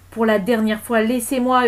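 angry, forceful shout; yelling. Acted. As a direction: yell a last warning. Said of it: "hurla Gilda".

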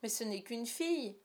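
scandalised big brother. A narrator's reading. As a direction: protest in outraged disbelief. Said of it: "fit John, scandalisé".

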